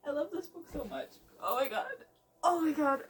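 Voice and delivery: quick, high, gushing "I love this book so much"; excited gush. Real, not acted. Reader gushes to viewers about her book, quick and high.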